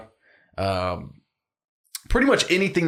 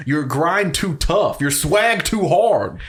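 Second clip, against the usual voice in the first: drawn-out, higher mock warning; mock-dramatic hype; 4 semitones higher, much slower than usual. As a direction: stretch it out like over-the-top hype. Hyping friend with mock warning.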